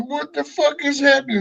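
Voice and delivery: high, startled cry of confusion; panicked fish. Pretend. Fish in the tube, shocked by what's happening, high and panicked. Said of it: "against the screen going".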